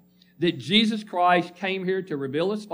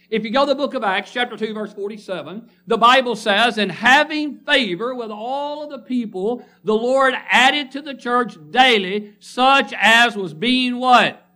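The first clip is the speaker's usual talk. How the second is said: forceful preaching; 6 semitones higher, 5 dB louder than usual. Acted. Preacher driving home a point to the congregation, loud and emphatic. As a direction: hammer the point home from the pulpit.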